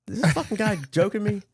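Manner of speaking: high, laughing incredulity; amused disbelief. Retold or imitated. Owner reacting to promised cookout, incredulous. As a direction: break into a laugh at how absurd it is.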